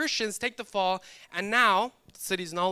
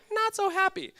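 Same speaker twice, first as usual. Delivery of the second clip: higher, sing-song not that happy; mock sad punchline; 13 semitones higher, 3 dB louder than usual. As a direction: toss it up high like a refrain. Repeated punchline refrain.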